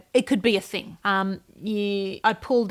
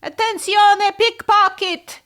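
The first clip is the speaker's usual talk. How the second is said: much higher, louder, slow mock Italian shout; Italian lady impression; 12 semitones higher, 10 dB louder, slower than usual. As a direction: belt it like an Italian lady spotting a thief. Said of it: "terrible impersonation of an Italian lady".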